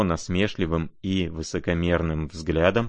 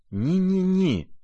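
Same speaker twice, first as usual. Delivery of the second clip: very high, drawn-out squeak of alarm; frightened warning; 11 semitones higher, slower than usual. A narrator's reading. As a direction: squeal a panicked, stretched warning under the breath. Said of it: "зашептал ему снова Лебедев с страшно испуганным видом". (испуганно, тоненько, протяжно)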